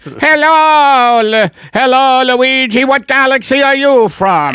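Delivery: Italian alien. Acted. Retired alien greets newcomer Luigi, Italian alien voice. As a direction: greet a fellow alien in broad mock Italian. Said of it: "retirement community for Italian aliens".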